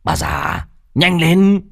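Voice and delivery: menacing son rushing his mother; threatening. A narrator's reading. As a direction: bark it out, rising to a loud threat. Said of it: "tỏ ý đe dọa". (quát đe dọa)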